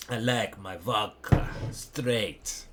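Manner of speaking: a light hint of a slow Russian grandmaster; drawn out at half speed, savouring it; Kramnik style. Streamer jokes about vodka, in a lightly voiced Kramnik manner. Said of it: "Kramnik style".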